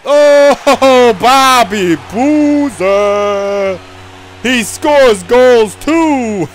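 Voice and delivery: slow, loud laughing boast; goal celebration. Acted. Bobby Boozer celebrating his goal, slow loud laughing boast. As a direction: drag out the name in triumph.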